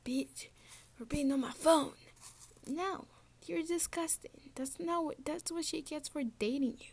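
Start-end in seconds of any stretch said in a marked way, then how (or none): 0.0-1.9 loud taunting mock boast; mock tough guy. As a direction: ham up the smug filmer. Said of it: "filming yourself and being like".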